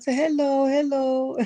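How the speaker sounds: a small child's sing-song greeting, imitated; mimicking a small boy. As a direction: pipe it up like a tiny boy teasing. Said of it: "he just looked at me and said".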